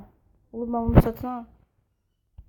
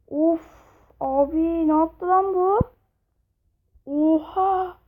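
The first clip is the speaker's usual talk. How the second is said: higher, louder disbelief at the kill; stunned shock; 6 semitones higher, 3 dB louder, slower than usual. Real, not acted. Gamer to viewers, shocked at a brutal finishing move. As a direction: gasp it out in amazement.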